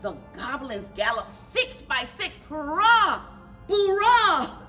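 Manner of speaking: ordinary delivery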